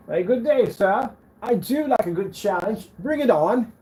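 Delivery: posh high English accent, played up; mock-English gentleman, high and loud. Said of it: "I'm not mocking the English accent".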